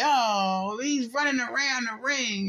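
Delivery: high, mock whiny complaint; mockingly high-pitched fan protest; mocking complainers. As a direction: imitate a griping fan up high. Mimics complaining boxing fans.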